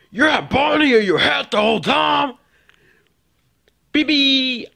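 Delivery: high squeaky puppet voice, shouting; squeaky toy voice. Pretend. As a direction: play a tiny excited toy friend. Toy character voiced by kid.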